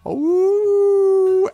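long high wolf howl; one sustained, high-pitched howl. Pretend. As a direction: howl like a wolf, loud and drawn out. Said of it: "And Snow goes".

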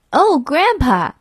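high, drawn-out little toad cry; slow, high exclamation; admiring cry. A narrator's reading. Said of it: "he cried".

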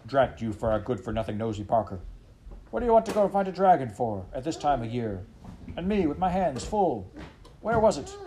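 put-on an old farmer losing his temper; irritated farmer rant. Farmer to dog, building exasperated rant.

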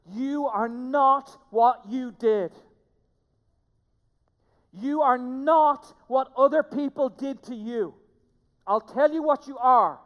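slow, loud, emphatic preaching. Real, not acted. Pastor to church, slow and loud with emphasis. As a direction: drive each word home, slowly and loudly. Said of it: "I can only say this as emphatically as I can".

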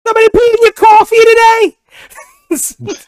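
mock a grumpy comedian needling someone; Gilbert Godfrey. Trying Bill Burr angry, comes out squawking Gilbert Godfrey voice. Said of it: "that just became Gilbert Godfrey".

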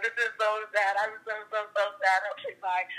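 ordinary talk

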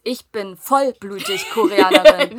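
loud, slow, every word hammered; emphatic declaration. Real, not acted. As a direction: proclaim it loudly and slowly. Stressing her Korean identity. (laut und nachdrücklich)

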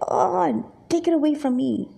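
acted divine exasperation; exasperated. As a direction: push it away in weary disgust. Voices God sickened by offerings.

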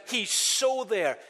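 He's "so" drawn out slowly and leaned on; slow, weighty "so"; stress on so. Real, not acted. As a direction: stretch the word "so" long and slow. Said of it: "You notice the emphasis".

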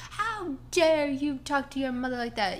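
hammed-up mom telling off her kid; imitating an angry mom. Neighbor mom yelling at her child, mock-scolded. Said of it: "Mom lost it again. She goes".

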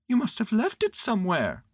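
patiently sure of himself; high little bear voice; matter-of-fact Pooh. A narrator's reading. Pooh's voice.

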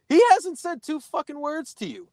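high-pitched mock outrage; indignant protest. Teller objects that the buddy said nothing, indignant. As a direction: squeal it in disbelief on the buddy's behalf.

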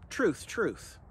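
ordinary talk

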